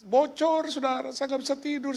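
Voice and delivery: high-pitched mock lament; meniru orang mengeluh. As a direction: imitate a pleading complaint, high and fading. Meniru keluhan orang minta bantuan. (keluhan tiruan bernada tinggi)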